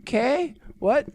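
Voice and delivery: confused okay what. Retold or imitated. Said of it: "I'll be like".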